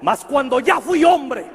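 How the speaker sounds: loud call. Real, not acted. Preacher leads the congregation in a loud shouted chant. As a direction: lead the crowd in a big shouted refrain. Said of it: "digan conmigo fuerte".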